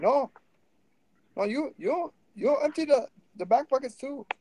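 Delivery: put-on high-pitched Indian accent, insisting; Indian accent impression. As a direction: demand the back pockets in a mock Indian accent. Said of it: "accuracy of accent replication is unimportant".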